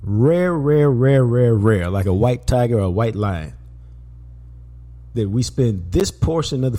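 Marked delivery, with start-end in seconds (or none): 0.0-1.9 each "rare" hit harder; repeated emphasis. Acted. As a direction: hammer the word "rare" over and over. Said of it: "it's rare".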